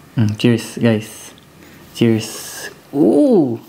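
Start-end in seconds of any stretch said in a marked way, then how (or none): 2.9-3.6 louder, higher delighted ooh; surprised ooh. Real, not acted. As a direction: react with delight. Reacting to crunch.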